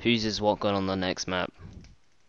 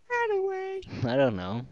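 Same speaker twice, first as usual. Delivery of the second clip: mock high voice; 3 dB quieter, much slower than usual. Mock reply about Burger Town.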